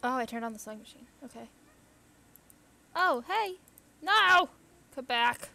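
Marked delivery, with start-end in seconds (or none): 3.0-5.5 high, loud shout after it; loud calling-out. Real, not acted. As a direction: yell to make it come back. Calling after fleeing creature.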